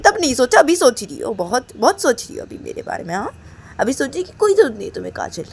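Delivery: loud, bitter reproach; angry outburst. Acted. Reshma blaming Kajal for the reckless bike ride, loud and bitter. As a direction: throw the blame back at her.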